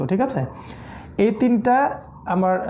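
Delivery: ordinary talk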